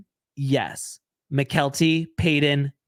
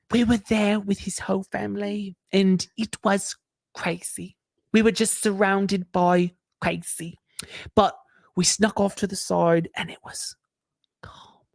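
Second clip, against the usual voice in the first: breathy dramatic whisper; 3 semitones higher than usual. Retold or imitated. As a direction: whisper it like a dreamy confession. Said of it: "I just wanna perform it for you".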